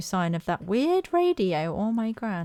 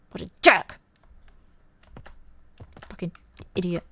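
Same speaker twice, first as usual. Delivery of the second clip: normal talking voice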